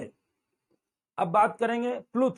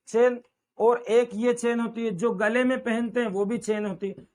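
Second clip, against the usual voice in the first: ordinary delivery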